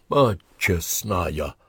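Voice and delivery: slow, stunned exclamation; gasped. A narrator's reading. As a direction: gasp it slowly, awestruck. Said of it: "ахнул Харламыч".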